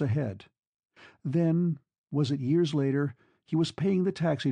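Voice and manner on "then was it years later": plain reading voice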